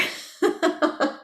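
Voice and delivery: real high chuckle; light laugh. Real, not acted. Teacher amused at having to breathe somewhere, chuckling.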